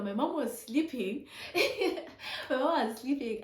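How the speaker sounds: giggling through the words; real laugh spilling into the line; laughing as she remembers. Real, not acted.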